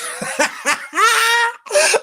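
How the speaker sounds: high loud mocking cackle; cackling laugh. Real, not acted. Laughs at her.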